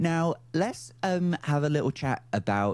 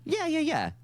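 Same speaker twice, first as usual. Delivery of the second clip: usual speaking voice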